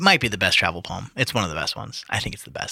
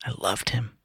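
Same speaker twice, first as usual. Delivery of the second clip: softer whispered confession; 8 dB quieter than usual. Acted. Reading her grieving line aloud, fading whisper. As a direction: let it fade to a breath.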